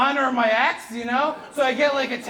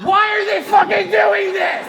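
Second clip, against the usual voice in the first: loud, higher, laughing disbelief; laughing outburst; 7 semitones higher, 7 dB louder than usual. Real, not acted. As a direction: throw up your hands laughing.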